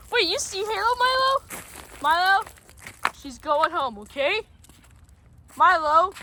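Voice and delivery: normal talking voice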